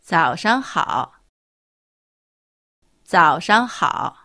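早上好 drawn out slowly, each syllable distinct; slow, careful 早上好 for learners; teacher slowly modelling 早上好 for the class; slow model pronunciation. Shown as a demonstration. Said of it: "例えば、おはようは".

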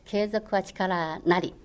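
継続は力なり spelled out very slowly; slow, deliberate maxim; slow proverb. Shown as a demonstration. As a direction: give each word of 継続は力なり its weight.

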